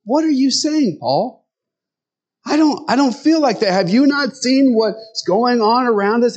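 high, disbelieving protest; incredulous disbelief. Retold or imitated. Doubting listeners challenge Paul, incredulous and high-pitched. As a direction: push back on Paul in shocked disbelief. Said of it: "they probably looked at him like".

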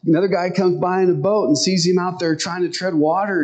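ordinary talk, nothing marked

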